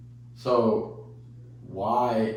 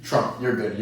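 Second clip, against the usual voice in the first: ordinary delivery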